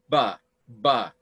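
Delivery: "ba, ba" with buzzing voiced b; voiced ba sound. Shown as a demonstration. Teacher showing class a voiced b sound. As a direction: demonstrate voiced "ba, ba" clearly. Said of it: "we have voiced B".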